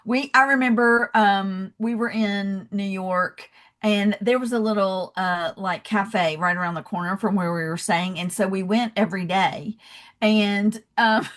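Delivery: normal talking voice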